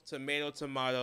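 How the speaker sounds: first "Tomato" with 'ay', second "tomato" with 'ah'; two pronunciations. Shown as a demonstration. Co-host showing either way is fine, contrasting pronunciations. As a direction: contrast "Tomato" as to-MAY-to, then to-MAH-to. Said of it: "You could say it however you like".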